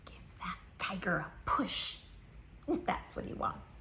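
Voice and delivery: conspiratorial whisper. A narrator's reading. Reader letting children in on the secret, whispering.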